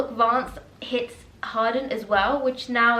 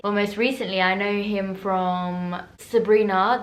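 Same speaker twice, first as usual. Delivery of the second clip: ordinary talk, nothing marked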